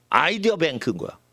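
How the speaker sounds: ordinary talk, nothing marked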